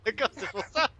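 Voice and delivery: laughing. Real, not acted. Realizes mistake, laughs.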